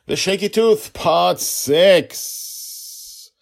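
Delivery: high, loud, grand title call; title announcement. Pretend. Host announcing today's episode, loud high fanfare. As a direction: ring out the title like a showman.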